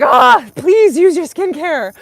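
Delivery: high, loud, slow pleading cry; pleading plea. Retold or imitated. Pleading with skin-fasters, high and loud. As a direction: beg them to use their skincare. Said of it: "I'm like".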